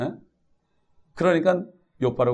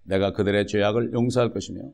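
ordinary talk, nothing marked

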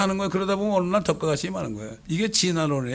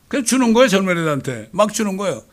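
loud, high denunciation; fiery sermon outcry; forceful preaching; 5 semitones higher, 6 dB louder than usual. Acted. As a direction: hammer the point home from the pulpit. Preacher builds loud accusation.

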